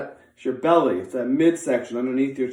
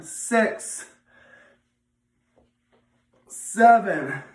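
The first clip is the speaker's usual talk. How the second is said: barking gym coach; 3 semitones higher, slower than usual. Real, not acted. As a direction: bark out each rep. Coach counting sit-up reps.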